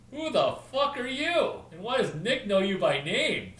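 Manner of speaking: loud, high incredulous demand; baffled crowd. Retold or imitated. Conference crowd shocked that Nick knows Larry. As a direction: bark it like a stunned onlooker.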